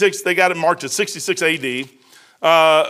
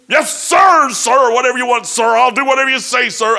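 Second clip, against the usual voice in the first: higher, louder yes-sir bark; mock eager shout; 5 semitones higher, 4 dB louder than usual. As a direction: shout back like an overeager sailor. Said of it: "as they're yelling at me".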